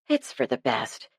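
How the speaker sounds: soft, low and soothing; calm a sulking daughter gently. A narrator's reading. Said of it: "said Lucy in a soothing tone".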